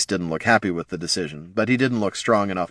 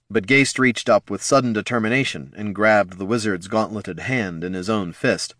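plain reading voice

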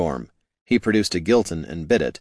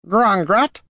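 much higher, puzzled goblin chirp; goblin squeak; 13 semitones higher, 5 dB louder than usual. Acted. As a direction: ask like a little goblin. Goblin asks in his tongue.

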